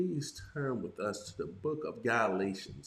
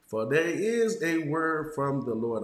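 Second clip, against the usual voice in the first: preaching proclamation; 6 semitones higher, 6 dB louder, slower than usual. Acted.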